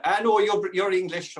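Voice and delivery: a touch of a Cork heckler, scoffing; lightly voiced Irish local insisting; Cork audience. Cork crowd dismissing the comedian, mild mock Irish. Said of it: "playing in Cork".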